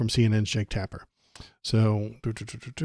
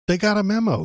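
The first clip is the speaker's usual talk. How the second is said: mocking; 9 semitones higher, 5 dB louder, faster than usual. Real, not acted. Mocking the memo excuse.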